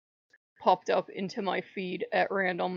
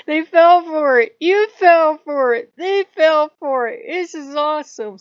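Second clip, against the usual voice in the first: high, loud whooping triumph, slow and gloating; gleeful gods cheering; 7 semitones higher, 15 dB louder than usual. Retold or imitated. Norse gods cheering as Odin's ambush scatters the others, loud high glee. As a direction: crow with delight that the trick worked. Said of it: "all the Norse gods are like".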